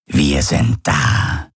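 langsame, tiefe, raunende Wesensstimme; fremdartiges Wesen. Acted. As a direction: jedes Wort schwer und bedächtig setzen. Gaurus spricht. (Gaurus raunt langsam und tief seinen Gefährten zu)

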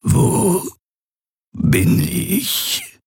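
matte, kraftlose Dämonenstimme; erschöpft, schwach. A narrator's reading. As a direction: nur noch ein schwacher Abglanz seiner Stimme. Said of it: "Sie klang erschöpft, nur eine blasse Kopie". (erschöpfte Frage des Gefangenen, kaum hörbar)

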